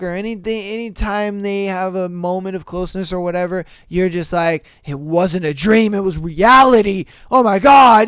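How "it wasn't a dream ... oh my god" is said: mocking the girlfriend who insists the dream was real, getting louder and shriller; hysterical mock outburst. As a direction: ham up the paranoid girlfriend losing it.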